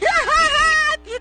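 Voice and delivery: high squealing laugh at herself; embarrassed burst of laughter. Real, not acted. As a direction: laugh out loud, caught in a mistake. Caught mixing up the accent. (risa avergonzada y aguda)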